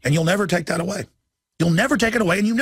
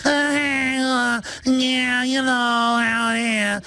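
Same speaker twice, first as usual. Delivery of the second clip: high, slow, mincing mock voice; much higher, slowed-down parody of a timid sweater-wearing man; mocking effeminate impression; 7 semitones higher, much slower than usual. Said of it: "He does that 'cause he thinks that's who you are".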